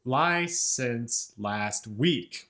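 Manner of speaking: slow spoken beats with license and week strong; strong weak weak strong. Shown as a demonstration. Teacher marks the strong beats slowly for the class. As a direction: tap out the stresses slowly. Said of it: "strong, weak, weak, strong".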